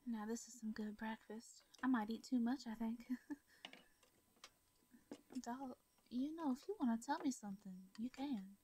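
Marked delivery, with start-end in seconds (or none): none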